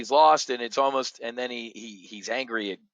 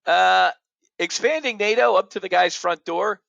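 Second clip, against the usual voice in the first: higher, louder mock-incredulous question; incredulous Pope; 7 semitones higher, 5 dB louder than usual. Pope asked about NATO expansion, in mock disbelief. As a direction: play the Pope as baffled and a bit indignant. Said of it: "even the Pope the other day I briefed you was like".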